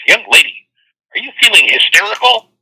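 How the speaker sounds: put-on high, scolding old-lady voice; indignant old lady. Old lady confronting a giggling girl in the theater, indignant. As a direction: demand it sharply, pitch climbing on the question. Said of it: "jabs this girl in the shoulder and says".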